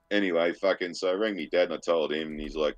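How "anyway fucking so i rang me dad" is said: usual speaking voice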